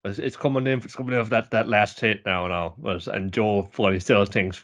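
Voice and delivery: normal talking voice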